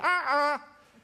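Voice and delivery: high puppet sounds through an apple; mouth full of apple. Acted. Puppet with apple in mouth answering the host, mumbled. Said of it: "vi må jo skjønne hva du sier".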